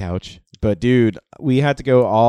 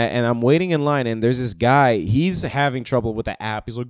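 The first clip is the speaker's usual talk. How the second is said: normal talking voice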